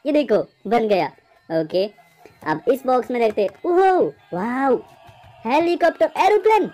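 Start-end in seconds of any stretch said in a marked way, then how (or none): 3.7-6.6 high, delighted toy reveal; excited discovery. Real, not acted. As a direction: call out the find, rising higher. Finds toy aircraft in box. (जोश भरी ऊँची आवाज़)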